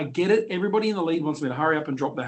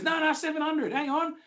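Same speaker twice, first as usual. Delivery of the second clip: urgent bloke; 12 semitones higher than usual. Retold or imitated. Bloke insisting from the car.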